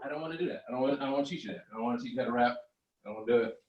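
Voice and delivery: ordinary talk, nothing marked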